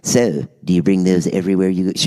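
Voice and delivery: low-pitched mock smart-ass question. Producer needling David in the party line, smart-ass. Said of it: "like, real smart ass".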